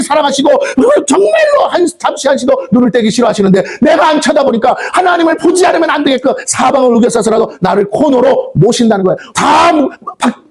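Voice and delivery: rising to a shouting climax; fiery preaching. Acted. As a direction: preach it at full force, slowing on key words. Preaching at full force.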